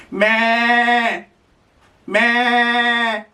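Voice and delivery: high bleating meh; goat bleat. Shown as a demonstration. Goat sound for toddler.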